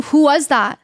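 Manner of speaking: a touch sharper demand to husband; voice rising. Retold or imitated. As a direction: let the voice rise on who. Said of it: "then my voice got a little".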